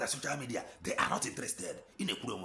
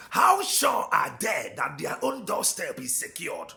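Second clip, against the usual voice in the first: loud preacher's demand, voice raised; shouted challenge; 5 semitones higher, 6 dB louder than usual. Real, not acted. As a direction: throw the question at the crowd. Preacher rebuking the doubters.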